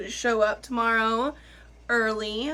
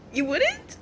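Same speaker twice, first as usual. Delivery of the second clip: mock hurt; 12 semitones higher than usual.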